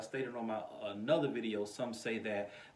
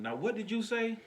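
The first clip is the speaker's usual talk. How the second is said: imitating a mother's high-pitched challenge; mock maternal rebuke, pitch jumping up; challenging mama; 11 semitones higher than usual. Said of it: "then Mama looking, Grandma looking".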